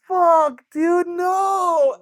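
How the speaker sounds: high, very loud yell of disgust; screaming no at the leak. Retold or imitated. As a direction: yell it in horror. Vomit leaks from the tablecloth.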